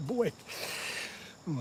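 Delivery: ordinary talk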